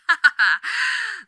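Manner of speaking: short, high, menacing laugh; a dark little laugh that hints at the threat; sinister chuckle. Acted. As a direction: demon laughing while warning the newcomer.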